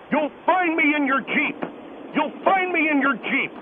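ordinary talk

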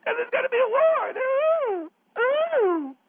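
high wailing alarm; host mocked as a frightened lady wailing; mock panicked announcer. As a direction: wail the warning like a frightened lady. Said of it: "there you come on going".

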